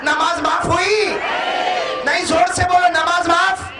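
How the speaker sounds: high, ringing call to the crowd; full-force preaching. Acted. Demands louder answer from crowd. (जोशीली तक़रीर, ऊँची आवाज़ में)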